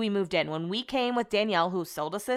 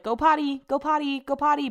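high sing-song dog command; repeated high-pitched potty cue; pet coaxing; 7 semitones higher than usual. Retold or imitated. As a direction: coax a puppy in a higher voice. Retold dog toilet command.

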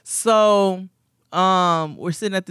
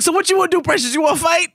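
pitched-up, loud "you wanna fight" dare; mock challenge; 14 semitones higher, 5 dB louder, faster than usual. Retelling to her friend, a loud mock dare to fight. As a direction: square up, half joking.

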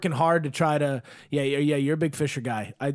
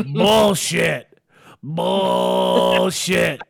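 dad calling bullshit; 5 dB louder, much slower than usual. Retold or imitated. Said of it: "And he goes".

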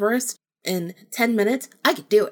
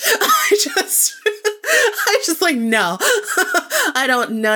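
laughing hard, pitch jumping up; 10 semitones higher, 6 dB louder, slower than usual. Real, not acted. Telling listeners about the spirit, laughing loudly. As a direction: giggle through the words, real laughter. Laughing while retelling refusal.

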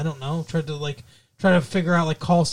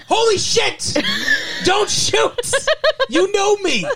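big panicked shout; slower than usual. Pretend. Host showing how Brandon should have reacted, a big panicked shout. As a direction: scream it like you're about to be shot. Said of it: "his response should have been so much larger".

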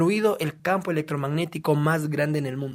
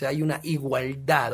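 normal talking voice

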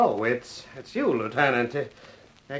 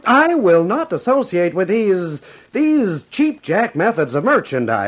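higher, louder, outraged refusal; indignant outburst; 9 semitones higher, 7 dB louder than usual. Acted. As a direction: explode with wounded pride. Manager quarrels with the boss.

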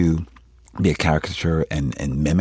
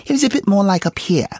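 much higher, louder voice, placed right up high; higher pitch; 5 dB louder than usual. Shown as a demonstration. Actor showing interviewer how Rustin's voice flourished, high-pitched. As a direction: push the pitch well up and project. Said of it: "it was even higher pitch".

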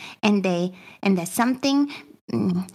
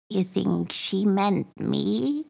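very slow, small, hesitant question; stiff, drawn-out uneasy question; frozen character; 4 dB quieter, much slower than usual. Acted. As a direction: ask it haltingly, barely moving. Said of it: "she's like, frozen".

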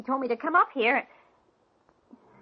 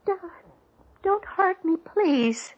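pleading; 5 semitones higher, much slower than usual. Acted. Victim begs killer.

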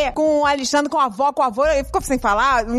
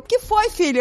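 mom's sleepy reply; 7 semitones higher than usual. Retold or imitated. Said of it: "Aí eu".

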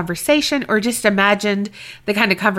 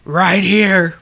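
gravelly Batman voice; 2 semitones lower, 3 dB louder than usual. Retold or imitated. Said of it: "my best, like, Batman on the spot kind of voice".

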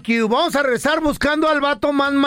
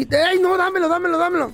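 high-pitched, insistent retold plea; urgent protest; 6 semitones higher than usual. Partner caught texting demands the phone back, urgent protest. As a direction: imitate a flustered grab for the phone.

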